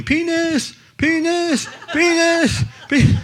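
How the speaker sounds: put-on high-pitched little-girl yelling, repeated; daughter yelling. Little daughter calling out in the art museum, high mock yell. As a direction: mimic a small girl calling out the word over and over. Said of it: "she's yelling".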